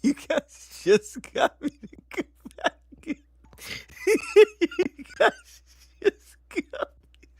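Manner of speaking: squealing through laughter; high laughing disbelief. Real, not acted. Streamer laughing at chat after a scare.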